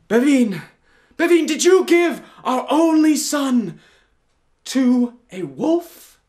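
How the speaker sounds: a horrified father crying out to his wife; shocked outcry. Acted. Prince confronts Bavine.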